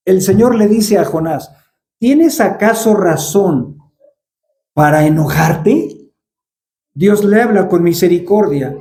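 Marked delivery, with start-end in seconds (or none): 2.0-6.1 slow and gentle, each word weighed; unhurried, tender rebuke; slow, gentle question. Retold or imitated. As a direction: ask it slowly, like a patient father. God's question, slowly quoted.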